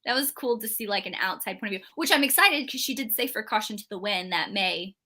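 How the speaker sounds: usual speaking voice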